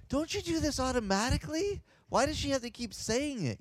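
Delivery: high-pitched inner complaint; baffled protest. Retold or imitated. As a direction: retell your thought as an incredulous protest. Puzzled inner protest at nurse's order.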